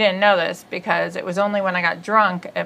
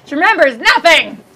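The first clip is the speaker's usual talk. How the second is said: exasperated; 8 semitones higher, 7 dB louder than usual. Real, not acted. Mock exasperation at her sister's memory.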